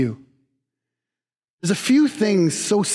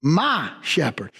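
ordinary talk

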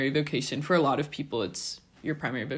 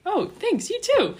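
much higher, bright little thanks; surprised thanks; 6 dB louder than usual. Retold or imitated. Sad stranger thanking a friendly student, pitched very high. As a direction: squeak out a surprised thank you.

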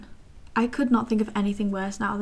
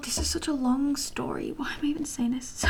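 softer, higher, whispered aside; self-conscious whisper; 4 semitones higher, 4 dB quieter than usual. Real, not acted. Catching herself rambling on camera: sheepish whispered aside. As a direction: mutter it to yourself, embarrassed.

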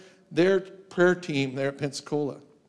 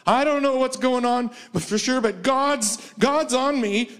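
shaken woman's voice; 8 semitones higher than usual. Retold or imitated. Shaking woman testifies in church.

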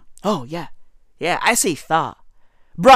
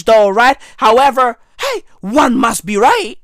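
ordinary talk

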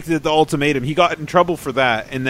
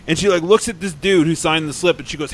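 ordinary talk

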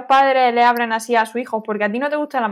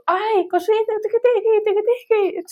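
high cooing voice to a cat; imitating pet talk; 9 semitones higher, slower than usual. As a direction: coo at the cat nonstop. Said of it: "le dio tanto cariño al gato". (voz aguda y melosa imitando cómo le habla al gato)

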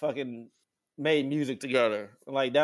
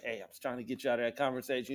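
softer, quick aside; confiding; 4 semitones lower, 8 dB quieter, faster than usual. Retold or imitated. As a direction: tell him on the side. Confiding to drummer.

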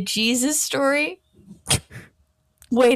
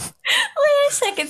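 much higher comic squeak; squeaky high voice. Pretend. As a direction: squeak it out in surprise. High squeaky mock exclamation.

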